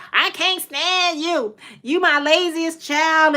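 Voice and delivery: high, drawn-out exasperated mother; mom scolding. Retold or imitated. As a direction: imitate a fed-up mom, stretching the words. Retelling mother's scolding as a child.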